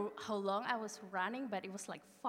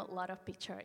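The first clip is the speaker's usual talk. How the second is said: ordinary talk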